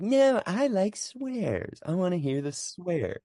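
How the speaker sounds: high, slow whine; childish protest against bleeped swears on TV; mock whiny kid. Kid self objecting to bleeps.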